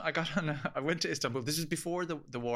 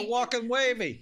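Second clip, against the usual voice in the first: high, loud mock New York; New York accent; 12 semitones higher, 8 dB louder than usual. Joking along about New York, loud accent. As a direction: shout it with a New York laugh. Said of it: "I was in New York".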